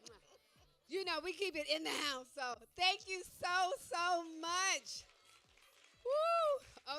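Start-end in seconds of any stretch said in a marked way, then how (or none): 0.9-5.0 excited. Real, not acted. Excited loud thanks. 6.0-7.0 high, loud excited woo; cheer. Real, not acted. Cheering for the kids.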